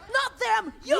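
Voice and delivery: high, loud pointed yell; cop's shout. Retold or imitated. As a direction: shout it right at her. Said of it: "And, and he goes".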